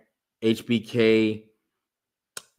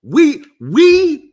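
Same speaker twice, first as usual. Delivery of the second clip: excited; 11 dB louder, much slower than usual. Retold or imitated. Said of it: "I'd be like".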